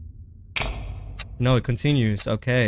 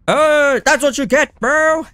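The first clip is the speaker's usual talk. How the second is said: gloating; 7 semitones higher, 6 dB louder, slower than usual. Real, not acted. Gloating at defeated enemy.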